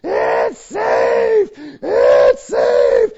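full-throated enraged shriek, very loud; blood-curdling scream. Retold or imitated. Dad imitating Sarah Jane's outrage at being tagged, screaming. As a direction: scream it like a child turned rage monster. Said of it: "screaming in this blood-curdling, terrifying scream".

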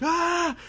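sudden high loud shriek of shock; startled scream. Retold or imitated. Boy shocked when the priest looks straight at him, loud high shriek. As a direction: shriek as if caught off guard.

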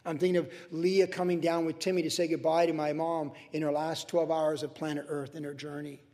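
ordinary talk

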